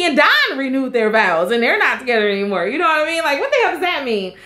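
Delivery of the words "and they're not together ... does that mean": ordinary delivery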